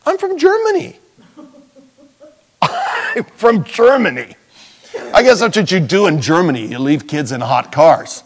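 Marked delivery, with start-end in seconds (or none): 0.1-1.0 very high, indignant excuse; mock German mother. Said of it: "And she said". 2.6-4.4 mock German accent. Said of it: "And she said".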